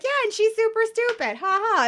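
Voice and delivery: mocking. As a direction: toss it off like a catty joke. Imagined mocking reply.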